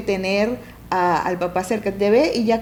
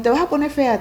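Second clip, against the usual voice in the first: mock scolding parent; 6 semitones higher, 4 dB louder than usual. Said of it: "o le decimos".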